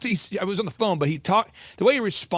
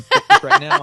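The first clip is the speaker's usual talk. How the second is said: breaking into real laughter on the punchline; higher and louder, laughing as he talks; laughing through it; 11 dB louder than usual. Real, not acted. Punchline about sneaking seafood.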